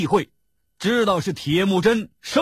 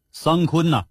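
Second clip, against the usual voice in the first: usual speaking voice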